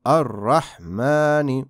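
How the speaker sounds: Ar-Rahmani drawn out very slowly; Ar-Rahmani spaced out, very slow; slow recitation. Shown as a demonstration. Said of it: "Vamos a recitar la palabra completa".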